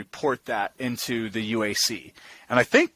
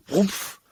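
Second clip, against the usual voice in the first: muffled, breathy whump; imitated snow whoomph; 5 semitones higher than usual. Shown as a demonstration. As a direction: puff out the sound of snow collapsing. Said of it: "if you hear that".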